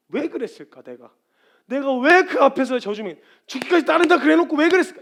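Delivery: loud, high agonizing at oneself; anguished self-reproach. Acted. Believer regretting a broken confession, anguished. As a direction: beat yourself up out loud. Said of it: "이렇게 괴로워하는 괴로움은요".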